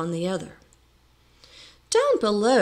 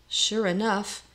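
usual speaking voice

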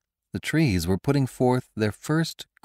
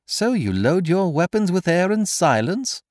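mocking; 11 semitones higher, 4 dB louder than usual. A narrator's reading. Stranger mocks after misfire.

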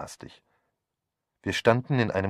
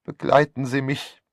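high, louder pitiful plea; plaintive; 6 semitones higher, 7 dB louder than usual. A narrator's reading. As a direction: beg pitifully for company. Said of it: "bat Misses Tanyos kläglich". (klägliches Bitten)

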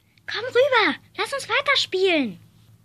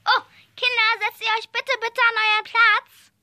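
usual speaking voice

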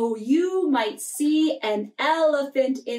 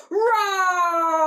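put-on high playful roar for the children; lion roar; 6 semitones higher than usual. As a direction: let out a cartoon lion roar. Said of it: "this is what he said".

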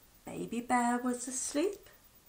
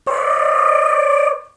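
very high, loud rattling ring; alarm clock ring; 16 dB louder than usual. A narrator's reading. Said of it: "Went the alarm clock".

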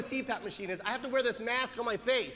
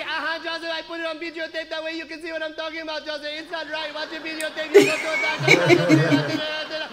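higher-pitched, insistent mother impression; nagging mom; 6 semitones higher, 8 dB louder than usual. Son imitates mom defending her videotape, nagging. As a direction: rattle it off on one high note, nagging.

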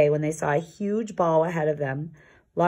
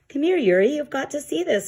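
much higher, excited; eager bear, pitched way up; eager call; 9 semitones higher than usual. Acted. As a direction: call the friend over, thrilled by a find. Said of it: "cried Lars eagerly".

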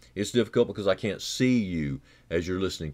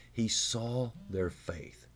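softer and slower, letting each word land; hushed, slow emphasis; 2 semitones higher, 7 dB quieter, much slower than usual. Shown as a demonstration. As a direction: drop the voice and savour the words. Repeats the phrase, hushed and slow.

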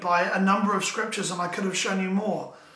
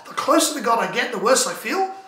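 quicker, louder, rising in disbelief; incredulous echo; 2 semitones higher, 4 dB louder than usual. Acted. Preacher echoing his old pastor's puzzling saying, incredulous. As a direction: repeat it as a baffled question. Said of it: "it always kind of confused me".

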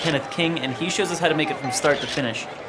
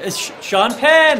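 gushing red carpet chatter; 7 semitones higher, 7 dB louder, slower than usual. Acted. Spotting a celebrity.

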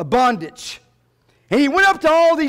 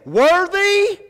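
high, drawn-out cry of worship; loud, lifted acclamation; loud proclamation; 9 semitones higher, 3 dB louder than usual. Acted. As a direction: call it out high to a congregation. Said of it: "With a loud voice, they sang this".

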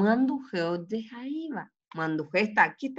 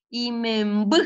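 "Imemb" leaning on the closing vowel; stress on final vowel; 3 semitones higher than usual. Shown as a demonstration. Teacher gives an example, "Imemb" with strong final vowel. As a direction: push the last sound of "Imemb". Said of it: "Suena fuerte la U".

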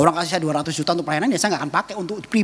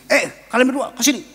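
mock fierce summons, raised and higher; fierce professor; 8 semitones higher, 4 dB louder than usual. Fierce professor summoning the couple, gruffly mimicked. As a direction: imitate a stern professor calling people over. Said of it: "profesornya ini galak banget".

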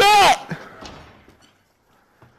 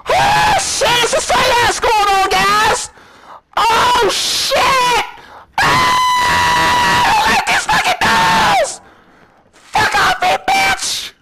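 panicked high yelling; freaked out by creepy dolls; scared shouting; 12 semitones higher, slower than usual. Real, not acted.

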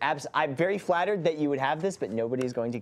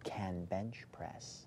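slow, distinct whisper; 7 semitones lower, 16 dB quieter, much slower than usual. Shown as a demonstration. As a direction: whisper each word clearly for a lip-reader. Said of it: "who's gonna whisper to me".

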